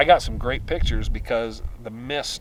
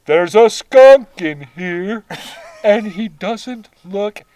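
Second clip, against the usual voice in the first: slow, high, trembling voice; shaky and scared, drawn out; quavering voice; 8 semitones higher, 9 dB louder, much slower than usual. Retold or imitated. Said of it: "your quavering voice".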